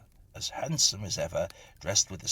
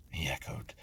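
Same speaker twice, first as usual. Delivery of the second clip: ordinary delivery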